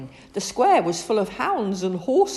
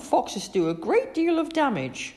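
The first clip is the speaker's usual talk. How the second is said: higher stern mother; lecturing mother voice; Mum voice; 10 semitones higher than usual. A narrator's reading.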